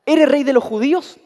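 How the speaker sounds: a preacher voicing Pilate's pointed question; Pilate asking. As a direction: demand it like a Roman governor.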